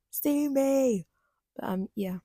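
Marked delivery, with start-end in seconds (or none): 0.1-1.1 playful defiance. Real, not acted. Repeated joking defiance.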